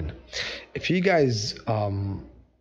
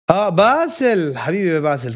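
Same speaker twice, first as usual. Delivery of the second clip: delighted greeting; 7 semitones higher, 6 dB louder, much faster than usual. Real, not acted. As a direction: greet him like a long-lost friend. Teacher greets remembered student Basil.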